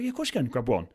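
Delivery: quiet, mildly put-on easy yes; soft pushover. Coach voicing the pushover handing over bottles, softly. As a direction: give it away without a thought. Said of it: "you're like".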